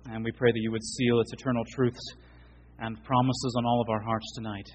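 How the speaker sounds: reverent prayer voice, unhurried; closing prayer. Acted. Pastor leading the church in closing prayer, reverent. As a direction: pray over the congregation humbly.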